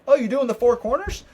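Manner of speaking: high, loud mock stranger's voice; imitating a curious stranger. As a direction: play the eager guy at the gas station. Stranger at gas station quoted.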